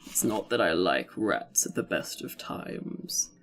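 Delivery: an unbothered cat drawling its wisdom. Acted. Reading the cat's lines.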